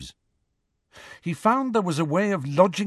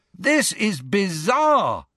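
distant yell; 9 semitones higher, slower than usual. A narrator's reading. Ron behind Harry in flight, distant yell. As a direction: stretch it out like a yell carried from behind. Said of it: "heard Ron yell from somewhere behind".

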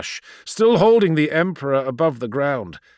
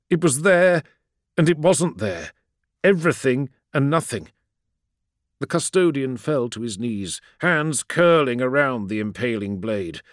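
plain reading voice

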